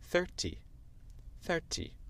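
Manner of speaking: British thirty with a crisp T; British demo, T of thirty pronounced; British accent. Shown as a demonstration. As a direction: say thirty the British way, thuh-tee. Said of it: "les Britanniques disent".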